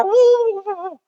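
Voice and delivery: high "wuh" of howling wind; imitated wind howl. Preacher showing the congregation a typhoon, imitated wind howl. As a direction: imitate a gale with a high whoop.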